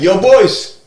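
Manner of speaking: put-on loud, high "Yo, boys"; casual greeting. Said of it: "It's not like saying".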